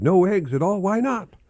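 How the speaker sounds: high, rising disbelief; surprised teacher. Acted. Teacher baffled by Marvin's answer, high and surprised.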